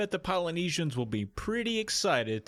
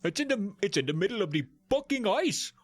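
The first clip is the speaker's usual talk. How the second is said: higher, mock Viking islander; a hammed-up Viking accent, higher voice; weird Viking accent; 10 semitones higher than usual. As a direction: play an annoyed Polynesian in a strange Viking accent. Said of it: "What is this accent that I'm doing".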